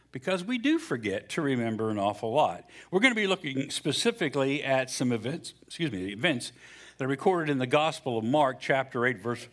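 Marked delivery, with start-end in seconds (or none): none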